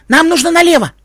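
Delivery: put-on high, loud child's voice; insistent boy. Tim argues for turning left, high insistent boy. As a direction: insist loudly in a boy's high pitch.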